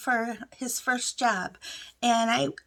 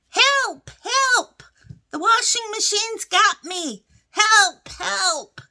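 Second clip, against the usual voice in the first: high, loud retold cries for help; screaming for help; 7 semitones higher, 5 dB louder, slower than usual. Woman head first in the washer calling her family, mock screaming. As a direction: yelp it out like a mock emergency. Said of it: "I'm screaming".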